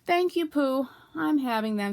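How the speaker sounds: usual speaking voice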